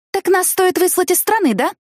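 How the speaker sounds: put-on a snide, nasty taunt at the old ladies; spiteful sneer. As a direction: throw their words back at them with spite. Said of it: "злобно спросила я".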